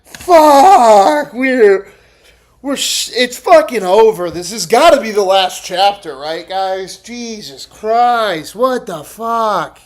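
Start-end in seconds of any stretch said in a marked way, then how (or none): 0.0-1.9 loud exasperated cry; exasperated outburst. Real, not acted. Streamer reacts loudly to the clip in disbelief. 7.0-9.8 drawn out, slow disbelief. Real, not acted. Streamer stunned by the clip, slow disbelief.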